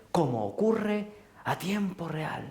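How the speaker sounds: ordinary talk, nothing marked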